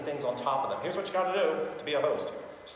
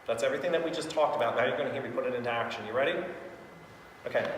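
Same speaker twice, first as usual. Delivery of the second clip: usual speaking voice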